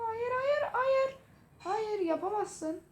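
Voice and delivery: high, slow whining cry of no; mock wail. Real, not acted. Gamer losing the match, wailing no in a high, slow cry. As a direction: wail in despair as the enemy wins.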